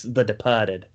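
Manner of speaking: put-on slow Boston accent, "The Departed" with the R dropped, Depahted; mock Boston accent. Co-host mocking how the movie says its title, slow Boston accent. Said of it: "I don't think the letter R exists in Boston".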